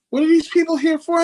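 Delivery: high-pitched imitation of baffled relatives; puzzled in-laws. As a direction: ask in mock bewilderment, voice way up. In-laws puzzled by running crowd.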